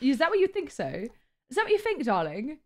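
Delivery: put-on playful British accent, swooping high then dropping. Teasing chat back, British accent. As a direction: put on a haughty British "darling". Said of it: "doing that fucking British accent".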